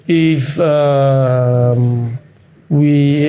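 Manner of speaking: lazy, dragging client voice with long ums; mimicking a painfully slow talker; slow mock drawl. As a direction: stretch every word like the slow client. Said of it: "The guy is trying to make a sentence".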